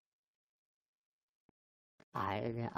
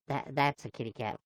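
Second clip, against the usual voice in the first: normal talking voice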